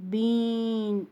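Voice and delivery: "bean" held on a long ee. Shown as a demonstration. Teacher helping pupils write the long sound, drawn-out E. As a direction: draw out the vowel in "bean". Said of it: "long sound of".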